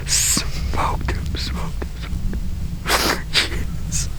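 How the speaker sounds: breathless whispered glee; excited whisper. Real, not acted. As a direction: whisper it, still thrilled. Whispered celebration after shot.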